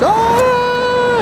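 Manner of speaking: high-pitched imitated kid scream. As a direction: mimic the children shrieking in fright. Kids went nuts, imitated scream.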